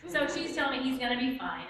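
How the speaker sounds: ordinary delivery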